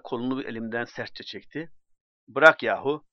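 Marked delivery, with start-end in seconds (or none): 2.3-3.1 angry shout. Acted. Drunk pulling arm away.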